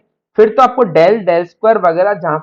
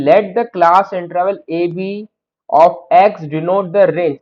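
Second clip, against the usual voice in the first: slow dictation; much slower than usual. Shown as a demonstration. Teacher dictating exam wording slowly.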